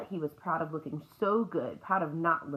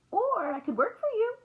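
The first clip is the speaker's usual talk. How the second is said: eager; 4 dB louder than usual. A narrator's reading. Eager girl pleads with shopkeeper.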